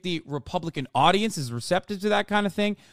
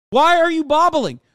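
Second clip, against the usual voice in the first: much higher and louder, disbelieving; incredulous yell; 11 semitones higher, 9 dB louder than usual. Real, not acted. Streamer yelling at a candidate on screen, high and loud. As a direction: shout the question in disbelief.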